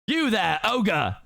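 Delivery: high pompous captain voice; guard captain. Acted. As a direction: call out the ogre officiously. Captain addresses Shrek.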